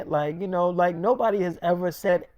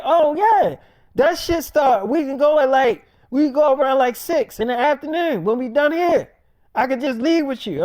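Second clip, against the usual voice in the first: much higher and louder, rushing with eagerness; excited Nick; 8 semitones higher, 6 dB louder than usual. Retold or imitated. Nick begging to tag along, high and loud. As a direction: jump at the chance, voice way up.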